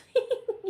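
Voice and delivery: high light chuckle; cheerful chuckle. Acted. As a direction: giggle brightly. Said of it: "she chuckled".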